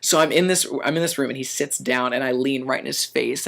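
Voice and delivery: usual speaking voice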